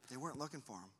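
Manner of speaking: barely above a murmur; hushed repeat. Shown as a demonstration. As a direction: let it sink in quietly. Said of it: "'Cause they weren't looking for him".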